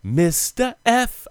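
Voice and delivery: high and slow, stretched out; high drawn-out catchphrase. Retold or imitated. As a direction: stretch it out high like a sitcom character. Echoing the catchphrase in character.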